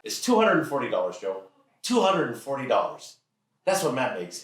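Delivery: insistent dad. Acted. Dad giving teen the sum, emphatic. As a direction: spell out the answer insistently. Father driving point home, retold.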